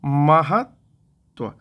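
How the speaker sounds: महत्व drawn out slowly, each sound separated; slow careful spelling-out. Shown as a demonstration. Teacher to exam students, slowly sounding out महत्व. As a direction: stretch out महत्व syllable by syllable. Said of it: "कैसे लिखाएगा?".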